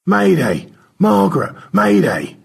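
high loud mayday calls over the radio; distress call. Acted. Stanley calls Margaret for help, loud distress call.

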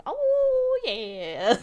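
high, loud drawn-out whoop, dropping off; hammed-up howl of pleasure; Old Man McGucket. Old man oiling himself up, mock relishing whoop. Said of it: "slapping his belly and going".

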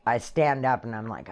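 normal talking voice

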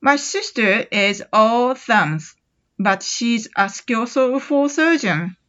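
very slow, careful reading; slow model sentence. Shown as a demonstration. Teacher reads slowly for students to repeat. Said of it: "これも後に続いてやってみ".

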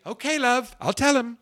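high-pitched British hostess impression; British accent. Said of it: "in her British accent".

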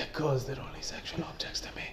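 breathy mock whisper; joking whisper of a dark confession. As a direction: whisper it like a creepy secret. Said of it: "should whisper that".

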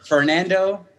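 big drawn-out guest introduction; ringmaster-style name reveal; mock announcer.

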